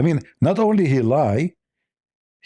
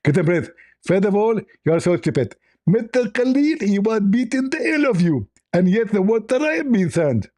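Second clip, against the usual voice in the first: higher, squeezed preacher voice; Zakir Naik impression; 6 semitones higher than usual. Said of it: "I am Doctor Zakir Naik".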